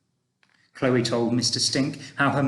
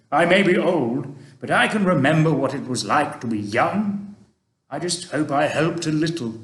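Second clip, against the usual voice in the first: put-on a kindly old gentleman, louder and gently advising; Mister Stink; 3 semitones higher, 5 dB louder than usual. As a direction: speak like an old tramp giving fatherly advice. Mister Stink consoling Chloe.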